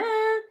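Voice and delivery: mock delighted gasp. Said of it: "you like".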